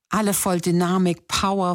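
ordinary delivery

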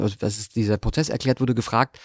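usual speaking voice

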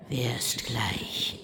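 slow, sinister whisper; slow menacing witch whisper. Acted. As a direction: whisper the threat like a rhyme. Witch threatening.

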